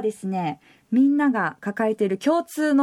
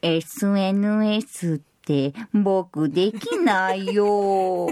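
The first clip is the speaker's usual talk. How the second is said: much lower, slow, sleepy bear voice; Pooh impression; 7 semitones lower, much slower than usual. She guesses the topic as Winnie the Pooh, low and slow. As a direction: drawl it like a dozy teddy bear. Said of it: "熊のプーだ".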